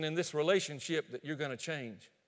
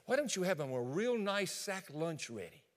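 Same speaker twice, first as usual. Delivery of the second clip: usual speaking voice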